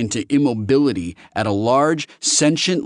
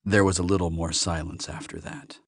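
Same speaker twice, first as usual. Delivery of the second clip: ordinary delivery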